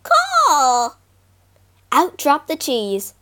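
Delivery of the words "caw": high squawking crow caw; loud caw. Acted. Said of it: "gave a loud".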